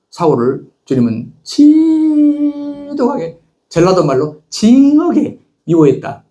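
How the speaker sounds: slow, high, stretched out on 지독하게 and 징하게; preacher stretching out the words for effect; drawn-out emphasis. Acted. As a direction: lean hard on 지독하게 and 징하게, dragging the words. Preacher dramatizes God's hatred.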